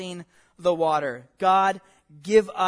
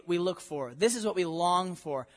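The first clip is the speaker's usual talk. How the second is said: ordinary talk, nothing marked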